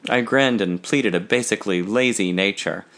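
plain reading voice

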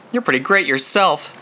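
ordinary delivery

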